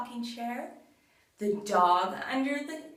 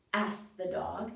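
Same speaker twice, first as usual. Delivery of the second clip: ordinary delivery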